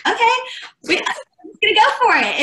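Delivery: squeaky nervous go-for-it voice; high anxious resolve; nervous self-pep talk. Retold or imitated. Her nervous self, retold.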